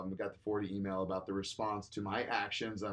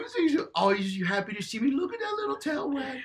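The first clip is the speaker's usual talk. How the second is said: put-on much higher, louder gushing baby talk; dog talk voice; 12 dB louder than usual. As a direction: gush over the dog like a toddler. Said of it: "Sup, baby?".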